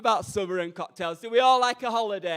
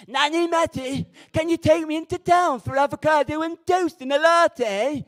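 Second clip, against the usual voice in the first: put-on child's voice; 8 semitones higher, 3 dB louder than usual. Jude Law's kids calling for their nanny, in a higher child voice. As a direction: play a small child looking up at the nanny. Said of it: "his kids would look up at me like this".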